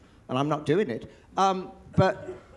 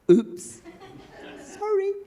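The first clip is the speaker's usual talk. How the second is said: sheepish oops; slower than usual. Retold or imitated. Comic mishap, sheepish apology.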